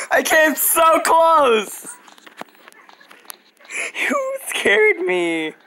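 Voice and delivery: high, drawn-out wail of dismay; upset whine. Real, not acted. Player startled just short of winning, upset whine. Startled and losing a close round.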